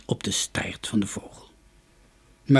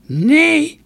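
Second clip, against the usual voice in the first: put-on high, loud, creaky old woman's voice; shrill-pitched storybook crone, full voice; 9 dB louder than usual. A narrator's reading. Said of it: "zei de oude vrouw".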